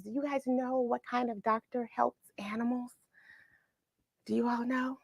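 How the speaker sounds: hushed, secretive teacher question; whispered question. Acted. Teacher asking kids quietly.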